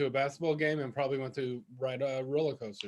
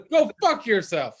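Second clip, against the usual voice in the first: other meaning; 4 semitones higher, 7 dB louder than usual. Shown as a demonstration. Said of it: "they can also mean".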